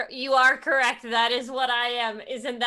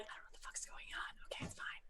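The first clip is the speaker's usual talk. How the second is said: fast, very hushed whisper; whispered aside; 24 dB quieter, much faster than usual. Acted. Adventurer aside to her companion about a strange man, whispering.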